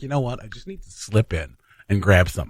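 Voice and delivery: sneaky quiet. Retold or imitated. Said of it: "they were like".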